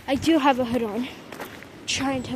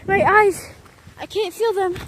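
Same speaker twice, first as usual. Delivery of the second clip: distressed; 5 semitones higher, 5 dB louder than usual. Real, not acted. Cold hurting her eyes.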